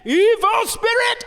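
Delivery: high, slow, dramatic priest's call; exorcist priest. Retold or imitated. Priest addressing the evil spirit, slow and dramatic. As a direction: summon the spirit, drawn out and high.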